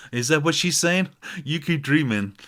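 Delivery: loud, mocking duet retort. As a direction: play the rival singer teasing. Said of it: "reminds me of [name] and [name]".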